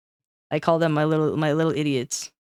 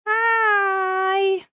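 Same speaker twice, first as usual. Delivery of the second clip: very high held greeting; squeaky high hi. Pretend. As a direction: stretch it out in a tiny voice. Greeting viewer in funny voice.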